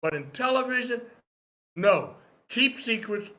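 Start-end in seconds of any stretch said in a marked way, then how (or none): none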